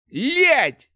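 loud, high-pitched curse; cursing yelp. A narrator's reading. Apprentice hit on the head with a stool, cursing loudly. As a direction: curse out from a sudden blow. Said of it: "выругался начинающий маг жизни".

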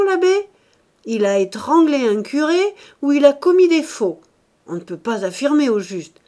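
insistent loud. Acted. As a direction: lay it on loud and sure. Husband insists loudly.

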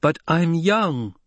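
high, slow, drawn-out indignant plea; insist, stretching out "young"; insistent repeated protest. A narrator's reading. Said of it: "Hara repeated".

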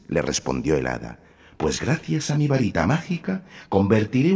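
reassuring fairy godmother. A narrator's reading.

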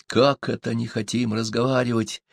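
ordinary delivery

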